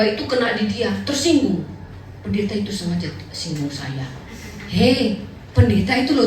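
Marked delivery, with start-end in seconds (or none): 2.3-4.1 sulky complaint. Retold or imitated. Jemaat tersinggung, voiced complaint.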